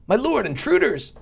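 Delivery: high-pitched hammed-up alarm; cartoonish minion calling out; alarmed underling. Voices an alarmed game underling.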